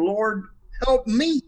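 slow and high, rising onto "me"; stress on me. Shown as a demonstration. Showing the panel the last reading, stress on me. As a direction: make "me" the point, pleading for herself. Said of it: "put the emphasis on those different words".

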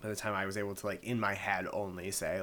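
ordinary delivery

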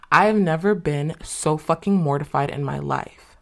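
usual speaking voice

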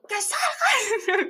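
slow high laughing command; mock angry order. Mother ordering the man to come out, slow high and laughing. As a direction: stretch out the order, half laughing.

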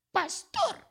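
very high put-on woman's voice; mocking woman's voice. Pastor imitating the woman at the church door, very high. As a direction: mimic the woman's shrill warning. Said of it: "es que ella hablaba así".

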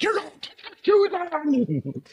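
very high, loud, slow mock scream; parent yelling. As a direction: yell it downstairs at the kids. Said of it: "I'm screaming from downstairs".

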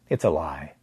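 usual speaking voice

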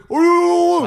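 high, loud wordless holler; imitated yelling. Street vendor calling to passers-by at the station, mock yelling. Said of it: "והוא צועק".

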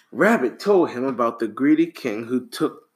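ordinary delivery, nothing marked

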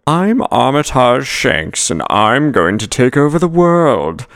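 high, slow hammy cartoon villain; supervillain voice. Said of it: "like a supervillain".

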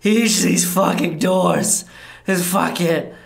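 high, loud frustrated ranting; a lost vampire cursing his maze; grumbling Laszlo. Acted. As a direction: curse at the endless doors. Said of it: "he's like".

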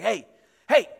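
retold attention-getting holler; mock yelling. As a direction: call out to get her attention, acting the angry neighbor. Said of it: "he's, like, yelling".

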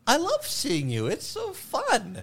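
high, slow, sweet imitating a waitress; mock waitress voice.

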